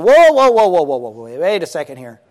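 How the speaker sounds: mock-startled protest, dropping in pitch; alarmed objection. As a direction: cut in to halt someone, then ease off. Acts out Martha halting Jesus.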